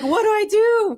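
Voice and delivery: high, mock-helpless question; mock lost wife. Teasing his wife, high mock panic. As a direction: squeak it like she's lost. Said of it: "you're just gonna be like".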